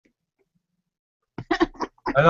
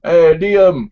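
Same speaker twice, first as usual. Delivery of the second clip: put-on Irish accent; 3 semitones higher, 6 dB louder than usual. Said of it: "fake Irish accent".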